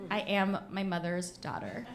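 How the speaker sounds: ordinary delivery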